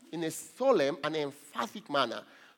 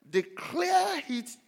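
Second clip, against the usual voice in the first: "declare" stretched out high; slow, ringing "declare it"; emphatic key word; 12 semitones higher, much slower than usual. Real, not acted. Preacher driving home the word in the verse, emphatic.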